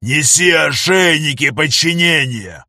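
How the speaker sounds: loud, slow barked order; yelling at full voice. Acted. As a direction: bellow it across the room. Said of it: "кричит во всё горло".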